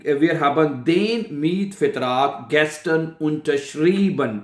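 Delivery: German example drawn out word by word; slow it right down for learners; slow model sentence. Shown as a demonstration. Teacher repeats an example sentence, slowly and deliberately.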